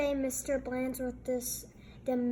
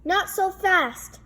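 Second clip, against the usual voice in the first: higher and louder, cautionary; big kid warning; 7 semitones higher, 8 dB louder than usual. A narrator's reading. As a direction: stop them like a know-it-all big kid. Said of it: "said a big kid from three oh nine".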